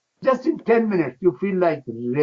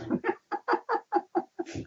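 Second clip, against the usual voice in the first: high breathy giggle; 8 dB quieter than usual. Real, not acted. Laughs at own impression.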